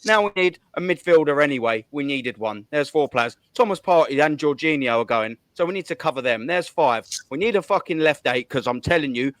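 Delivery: ordinary delivery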